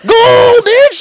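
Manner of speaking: high, loud cheer; excited shout. Retold or imitated. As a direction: cheer it with full force. Retold celebration.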